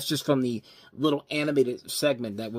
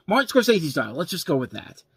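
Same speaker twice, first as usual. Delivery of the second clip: ordinary talk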